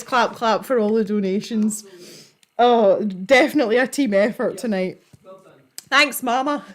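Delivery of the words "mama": ordinary talk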